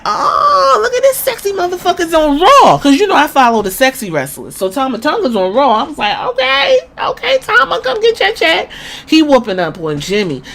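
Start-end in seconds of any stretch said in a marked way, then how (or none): 0.1-2.8 high-pitched loud swooning squeal; gushing squeal. Retold or imitated. Gushing over a sexy wrestler. 6.3-8.7 high-pitched teasing squeal; flirty squeal. Retold or imitated. Flirting with wrestler Tama.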